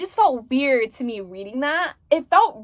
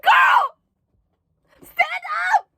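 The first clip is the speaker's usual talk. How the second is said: very high, loud crying shout; exasperated scream; 4 dB louder, slower than usual. Retold or imitated. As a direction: scream "girl, stand up" at the page. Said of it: "sorry for screaming".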